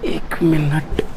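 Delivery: half-whispered aside to self; quiet aside. Real, not acted. Teacher to himself while wiping the board, quiet aside.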